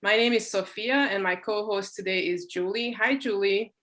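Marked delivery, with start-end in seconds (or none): none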